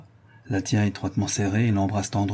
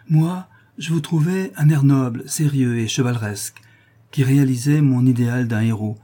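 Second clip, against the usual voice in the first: put-on young woman in love; 5 semitones higher, 5 dB louder than usual. Barbara's ardent declaration.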